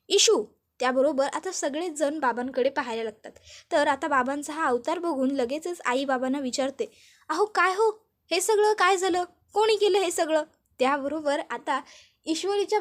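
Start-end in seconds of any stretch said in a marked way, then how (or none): 0.1-0.4 calling her name high and loud; angry shout. A narrator's reading. Father furious at finding Arnav home, shouting. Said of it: "जोरात ओरडतात". 7.3-10.4 higher, louder startled asking; worried and surprised, demanding what happened; alarmed question. A narrator's reading. Mother asking father who did this, alarmed.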